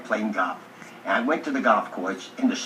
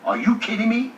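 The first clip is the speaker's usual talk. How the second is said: incredulous; 9 semitones higher, 4 dB louder than usual. Retold or imitated. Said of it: "looked at me like".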